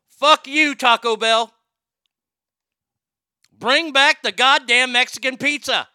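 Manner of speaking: loud, high, blustering announcement; mock proclamation. Podcaster declaring his chicken loyalty to listeners, hammed-up loud proclamation. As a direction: bellow it like a pompous public decree.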